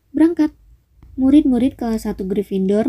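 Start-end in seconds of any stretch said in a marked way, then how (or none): 0.1-0.5 high, commanding send-off; brisk command. A narrator's reading. Headmaster dismissing the pupils to bed, commanding. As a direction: send them off with a lifted voice.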